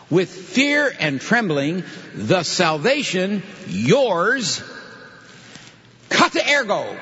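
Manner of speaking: slow, high recitation building to a punched end; drawn-out, emphatic quotation; literal rendering. Shown as a demonstration. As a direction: stretch each phrase, holding back the verb. Building the Greek-order sentence.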